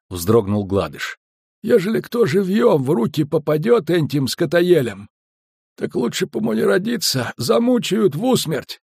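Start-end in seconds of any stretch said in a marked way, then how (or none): 1.6-8.8 higher-pitched, dread-filled warning; frightened skipper. A narrator's reading. Skipper warning about capture by elves, uneasy. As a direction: warn them as the uneasy skipper.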